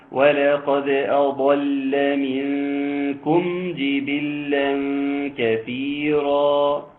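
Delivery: slow, melodic tajweed chant; teacher chanting the verse with full elongations; Quranic recitation. Acted. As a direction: draw each vowel out in a measured chant.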